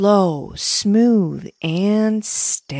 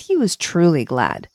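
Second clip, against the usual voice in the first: ordinary delivery, nothing marked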